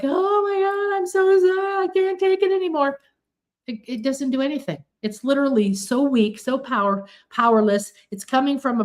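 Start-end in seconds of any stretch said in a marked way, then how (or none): 0.0-3.0 high, whiny mock despair; hammed-up high pleading; mock begging. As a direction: whine like you can't take it anymore. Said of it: "when we beg and when we're like".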